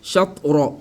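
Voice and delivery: "Shatra" with stopped t, shat-ro; no echo on the t of "Shatra"; without qalqala. Shown as a demonstration. Teacher shows the faulty reading, without qalqala. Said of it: "Mm, mm".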